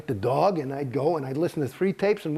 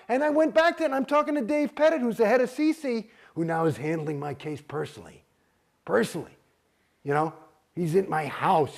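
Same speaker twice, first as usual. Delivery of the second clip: ordinary talk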